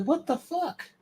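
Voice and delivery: high, quiet baffled quote; bewildered student. Retold or imitated. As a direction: squeak it out, totally lost. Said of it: "I was like".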